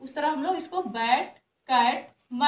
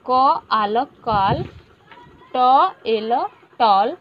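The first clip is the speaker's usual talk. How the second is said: put-on louder, slow sounding out for children; classroom phonics drill; 3 semitones lower, 10 dB louder, slower than usual. Shown as a demonstration. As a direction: call out each sound clearly to the class. Teacher leads phonics revision drill.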